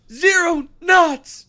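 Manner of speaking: loud, high, drawn-out cry; big hammed-up engineer's shout; retold yell. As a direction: yell the final count slowly and high. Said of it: "the whole time he's just yelling".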